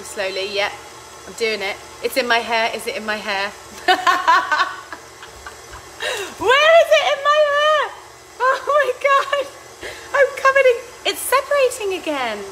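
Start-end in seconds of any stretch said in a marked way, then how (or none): 4.0-6.8 high squealing laugh at the mess; laughing at flour in her hair; loud shrieking laugh. Real, not acted. 6.8-12.4 much higher, louder panicked squeal; high frantic cry over the mess; flustered squeal. Real, not acted.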